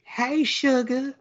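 high, slow, syrupy Southern drawl put on for a joke; mocking Rogue's drawl. Podcaster mocking a cartoon's bad accent, high slow drawl. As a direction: stretch it out like a bad Southern belle. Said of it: "That raspy".

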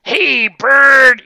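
high, loud call across the gym; shouted nickname; coach yell. Retold or imitated. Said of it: "he used to use the word bird".

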